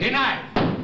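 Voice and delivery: sharp rising denial; curt denial. Acted. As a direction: snap the ruling. Judge cuts off counsel.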